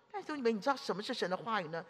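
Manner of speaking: ordinary delivery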